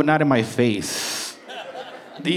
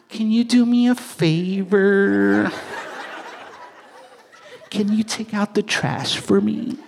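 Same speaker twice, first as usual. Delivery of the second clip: higher, slow, sugary asking voice; extra nice request; 7 semitones higher than usual. Retold or imitated. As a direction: ask sweetly like a new boyfriend. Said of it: "you're all extra nice".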